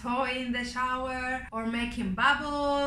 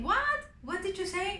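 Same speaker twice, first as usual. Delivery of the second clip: mock surprise; 7 semitones higher than usual. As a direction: pretend you didn't hear, squeak it up high. Mom feigns mishearing.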